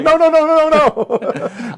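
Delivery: loud, high comic panic; hammed-up freak-out. Co-host pretends to panic at objects, hammed-up freaking out. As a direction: shout a rapid run of mock-horrified refusals. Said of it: "I'm over here freaking out going".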